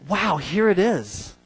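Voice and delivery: slow, hushed awe; awed wow. Retold or imitated. Man at the museum marvelling at skeleton, slow awe. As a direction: marvel at it slowly. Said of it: "and I thought".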